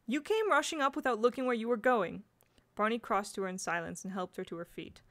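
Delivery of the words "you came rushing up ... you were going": louder, higher spirited retort. A narrator's reading. Jane answering the boy who hit her, spirited. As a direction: throw the blame back forcefully. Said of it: "she said with some spirit".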